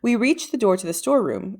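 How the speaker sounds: usual speaking voice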